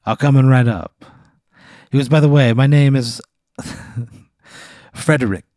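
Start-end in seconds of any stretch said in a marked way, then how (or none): 0.1-1.0 bad Italian accent. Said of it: "a really bad Italian accent". 2.1-5.4 put-on higher, laughing Italian accent; bad Italian accent. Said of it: "a really bad Italian accent".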